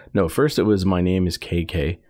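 ordinary talk

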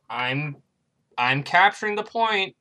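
a lightly done Norwegian accent. Shown as a demonstration. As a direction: announce the capture with a Norwegian lilt. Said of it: "That's my Norwegian accent".

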